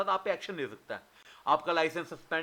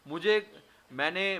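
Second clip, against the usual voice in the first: usual speaking voice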